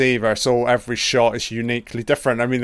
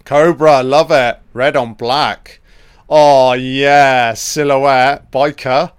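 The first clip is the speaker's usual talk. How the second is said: hyped up; 2 semitones higher, 9 dB louder, slower than usual. Real, not acted. Excited by the opening titles.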